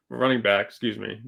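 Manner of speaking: usual speaking voice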